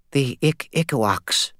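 high, slow and faraway, puzzled; distant, puzzled. A narrator's reading. Vampire sensing her fled creature, distant and puzzled. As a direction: sound lost far off, slowly puzzling. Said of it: "in a distant, puzzled voice".

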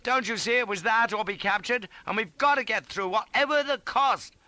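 loud, high, insistent argument; urgent harangue. A narrator's reading. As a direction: drive it home as a matter of duty. Harangue at East resumes.

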